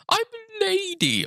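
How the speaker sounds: very high falsetto, mocking a man playing a woman; mocking lady voice. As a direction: squeak it out in a silly high falsetto. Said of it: "sit in a chair and go".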